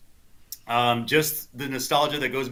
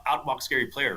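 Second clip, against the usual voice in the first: ordinary delivery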